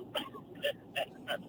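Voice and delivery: high breathy fake sob-laugh; mock crying laugh. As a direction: fake a whimper to mock him. Mocking crying after quote.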